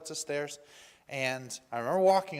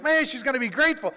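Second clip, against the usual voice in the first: high, loud, quick excitement; retold elation; 8 semitones higher, 7 dB louder, faster than usual. Helper expecting thanks from the woman, very excited. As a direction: re-enact eager hope, voice lifted. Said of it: "I remember the elation".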